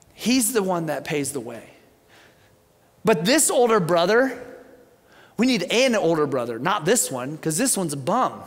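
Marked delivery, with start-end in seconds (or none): none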